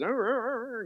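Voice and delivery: high mock baby arr; mock muffled baby sound. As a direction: imitate a baby's garbled sounds. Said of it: "all she hears is".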